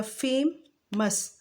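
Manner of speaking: फेमस very slowly in two parts, with a held m; slow two-beat demo of फेमस; two syllables. Shown as a demonstration. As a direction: pull फेमस apart into two beats. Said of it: "टू syllables हैं इसमें".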